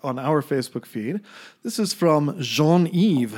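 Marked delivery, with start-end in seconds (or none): 1.6-3.3 French pronunciation. Shown as a demonstration. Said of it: "that is my attempt at a French pronunciation".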